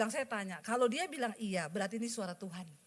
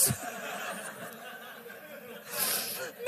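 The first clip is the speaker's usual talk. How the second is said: laugh; 2 semitones higher, 6 dB quieter than usual. Real, not acted. Laughs after God's rebuke.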